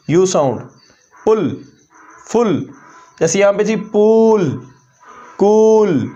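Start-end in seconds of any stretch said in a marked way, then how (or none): 3.9-6.1 पूल, कूल with a stretched long oo; long oo demo. Shown as a demonstration. Teacher contrasting long पूल with short पुल. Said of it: "quick way है".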